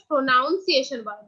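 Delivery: "প্রনাউন্সিয়েশন" with a NOUN middle, pro-NOUN-ciation; common mispronunciation. Shown as a demonstration. Teacher showing what people wrongly say, mispronounced with NOUN. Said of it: "This is not pronunciation".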